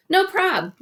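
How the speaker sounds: high, light call to another room. A narrator's reading. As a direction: call it casually from the kitchen. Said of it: "I called from the kitchen".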